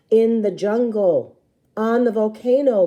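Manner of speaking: Rue's eager voice. A narrator's reading. Little sister explaining the jungle, loud and slow. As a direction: announce it like it's obvious.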